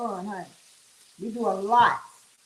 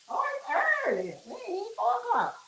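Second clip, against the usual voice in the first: surprised realization; 10 semitones higher, 3 dB quieter, faster than usual. Real, not acted. Checks the time, surprised.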